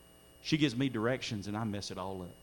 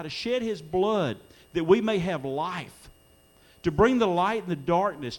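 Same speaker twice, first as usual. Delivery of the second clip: high, emphatic preacher's delivery, building louder; impassioned preaching; 7 semitones higher, 5 dB louder than usual. Real, not acted. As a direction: declare the promise to the congregation. Preaching with rising force.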